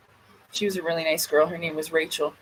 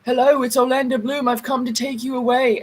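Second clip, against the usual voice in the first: higher-pitched mock British accent; Orlando Bloom; 3 semitones higher than usual. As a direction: put on a British accent, pranking from door to door. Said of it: "putting on a British accent".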